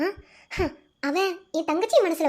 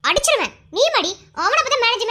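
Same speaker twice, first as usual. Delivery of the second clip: high, loud mock threat; raised, exasperated protest; annoyed scolding; 8 semitones higher, 5 dB louder than usual. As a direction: tell off a teasing friend. Mock threat at friend calling her manager.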